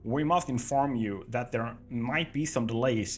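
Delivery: put-on deliberately terrible American accent; bad American accent. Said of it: "fake, bad American accent".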